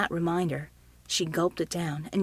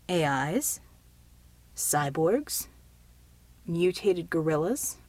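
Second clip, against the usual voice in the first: lower, tentative rising questions; cautious guesses; 3 semitones lower, slower than usual. A narrator's reading. Helper guessing what they are, cautious. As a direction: offer each guess warily, with pauses between. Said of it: "he asked cautiously".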